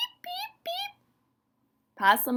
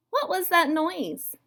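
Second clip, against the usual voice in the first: higher, puzzled possum voice; 10 semitones higher, 4 dB louder than usual. Acted. Storyteller voicing the possum, lightly puzzled. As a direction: wonder aloud, just woken.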